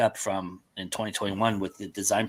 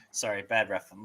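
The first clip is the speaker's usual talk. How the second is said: normal talking voice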